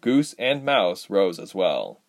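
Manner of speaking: usual speaking voice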